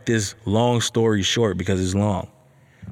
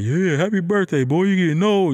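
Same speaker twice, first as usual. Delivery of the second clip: playful clowning; 8 semitones higher than usual. Acted. Dad clowning his son about getting old, playful teasing. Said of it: "He's over here clowning me".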